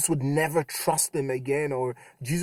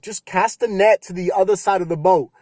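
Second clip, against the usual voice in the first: shouted call; 4 semitones higher, 8 dB louder than usual. Retold or imitated. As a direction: call out loud across the water. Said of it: "Jesus shouted out to them".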